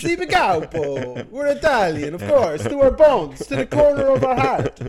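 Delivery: mock Italian-American guy. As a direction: swear it to your bones like a cartoon mobster. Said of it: "continuing the Italian bit".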